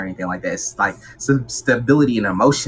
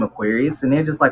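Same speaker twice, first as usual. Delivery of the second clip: usual speaking voice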